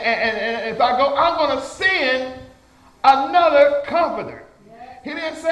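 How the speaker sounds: building sermon declaration; fervent preaching. Real, not acted. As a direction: proclaim it from the pulpit, stretching the big words. Proclaims scripture with raised force.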